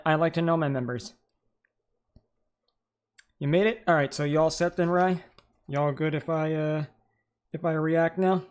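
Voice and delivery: talking while chewing; words round a mouthful of food; mouth full. Real, not acted. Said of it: "I apologize if I sound like I'm chewing to death".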